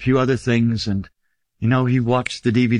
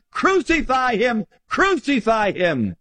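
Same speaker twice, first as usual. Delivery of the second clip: crowd crying crucify; 9 semitones higher, slower than usual. Acted. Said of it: "were crying".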